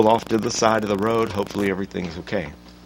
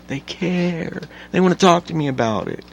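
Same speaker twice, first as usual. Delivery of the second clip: sarcastic mock concern; 8 semitones higher, 3 dB louder than usual. Mocking news people's fake concern.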